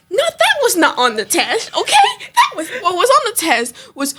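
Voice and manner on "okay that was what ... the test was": ordinary talk, nothing marked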